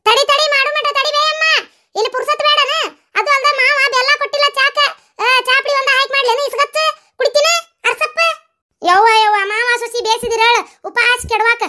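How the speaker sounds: high put-on voice of a grumbling old woman; grumbling mother-in-law. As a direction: complain in a high character voice. Second character scolding in high voice. (ಎತ್ತರದ ಧ್ವನಿಯಲ್ಲಿ ಗೊಣಗುವ ಅತ್ತೆ)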